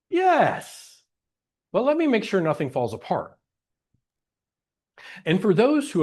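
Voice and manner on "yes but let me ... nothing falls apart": very high acted yes, then a quicker proviso; mock-eager agreement that backs off; eager but stalling. Speaker voicing people afraid things fall apart, mock-eager stalling. Acts someone putting off joy.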